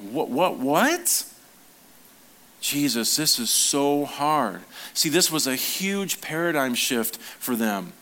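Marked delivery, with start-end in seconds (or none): 0.1-4.6 mock disbelief. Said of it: "mock disbelief at hard teaching".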